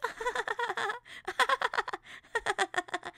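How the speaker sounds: high-pitched fake sitcom laugh; mock audience laughter. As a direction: imitate a studio audience cracking up. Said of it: "everybody's like".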